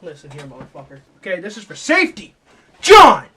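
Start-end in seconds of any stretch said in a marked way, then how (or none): 2.8-3.2 high, very loud name call; hammed-up, falling yell of reprimand; scolding shout. Scolding the songwriter.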